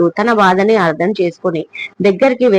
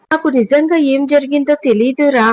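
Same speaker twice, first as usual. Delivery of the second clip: mother's reply pitched much higher, called across; calling back loudly; 7 semitones higher than usual. A narrator's reading. Mother calling back to her son down the corridor, high mild shout. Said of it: "తను గట్టిగా అరుస్తుంది జవాబుగా".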